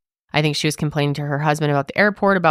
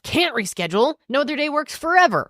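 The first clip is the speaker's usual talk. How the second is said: mock aggressive quote; 9 semitones higher than usual.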